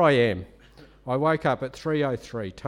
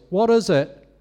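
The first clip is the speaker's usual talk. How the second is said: called out loud, a little higher; raised voice asking what they want; 4 semitones higher, 7 dB louder than usual. Retold or imitated. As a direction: ask it out loud across the room. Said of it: "I said in a loud voice".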